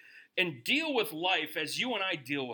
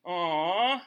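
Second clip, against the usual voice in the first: sympathetic groan; 6 dB louder than usual. Retold or imitated. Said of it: "we can look at each other and go".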